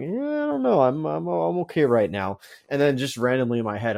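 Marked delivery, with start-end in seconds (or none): 0.0-2.4 much higher, unsure put-on voice; hesitant high voice. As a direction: squeak out a reluctant no. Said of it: "I was like".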